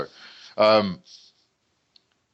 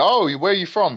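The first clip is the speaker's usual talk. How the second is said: higher-pitched, lightly imitated curious question; curious stranger; 8 semitones higher, 3 dB louder than usual. People asking the big fighter about his accent, lightly mimicked curiosity.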